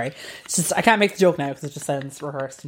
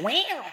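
high cat-like elephant call; squealed elephant noise that sounds like a meow; failed elephant impression; 11 semitones higher, 6 dB quieter than usual. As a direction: trumpet like an elephant but come out as a cat. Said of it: "it came out as a cat".

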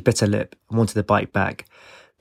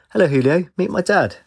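high, slightly raised greeting; loud; 8 semitones higher than usual. A narrator's reading. Daughter introducing her dad to Julio, loudly. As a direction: call it out higher, a little louder. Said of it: "said loudly".

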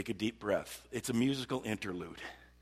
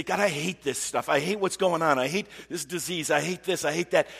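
higher, louder acted venting; raw emotion; 5 semitones higher, 6 dB louder than usual. Believer venting to God about sickness and troubles, raw. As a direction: pile up the complaints heatedly. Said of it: "the rawness of our emotions".

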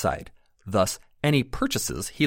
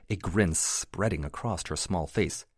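ordinary delivery

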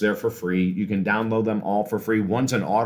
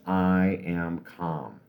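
slow, lower mantra on the exhale; slow calm affirmation; 4 semitones lower, 4 dB quieter, much slower than usual. Shown as a demonstration. Coach models the words to repeat on exhale, slow and calm. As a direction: let each word settle, slowly. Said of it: "do it very slowly".

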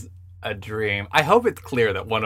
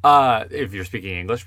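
normal talking voice

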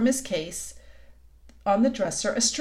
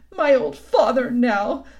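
slow, loud tearful lament; tearful confession; 3 semitones higher, 8 dB louder, slower than usual. A narrator's reading. Mister Tumnus speaks of his father, tearful. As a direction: lament it through tears.

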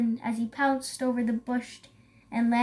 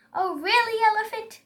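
high-pitched, put-out question; indignant cry; 10 semitones higher than usual. A narrator's reading. Zebra answers Elephant's insult about stripes, indignant cry. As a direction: cry it back at the critic. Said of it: "cried Zebra".

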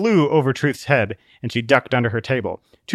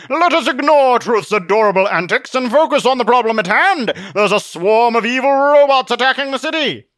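high, loud, sweeping proclamation; blustering Zealot, commanding; 12 semitones higher, 6 dB louder than usual. Acted. Zealot rallies them against evil robots, commanding bluster. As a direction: proclaim it like a grandiose hero.

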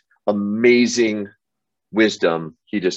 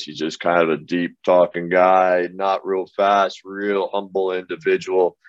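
deeper and slower, unhurried humble man; Paul's deep slow voice; 3 semitones lower than usual. Retold or imitated. Narrator imitating his humble friend Paul, deeper and slower. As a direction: lower your voice and take your time like Paul. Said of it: "Paul talks like this".

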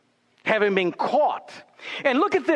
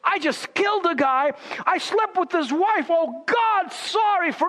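much higher, hammed-up dismay; mock remorseful David; 8 semitones higher than usual. Preacher mocking an imagined repentant David, higher. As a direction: play David's fake sudden regret. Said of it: "Did David wake up one day and says".

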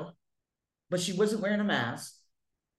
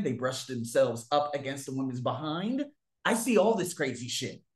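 normal talking voice

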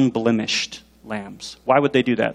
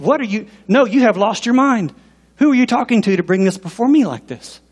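raised-pitch acted scolding; indignant rebuke; 6 semitones higher than usual. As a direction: rebuke like an affronted father. Plays God scolding like angry dad.

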